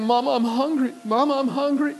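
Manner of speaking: a hint of a whining child; lightly voiced hungry boy. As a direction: plead like a small hungry child. Little boy begging his mother.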